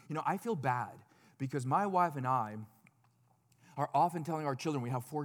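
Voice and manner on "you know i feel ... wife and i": ordinary talk, nothing marked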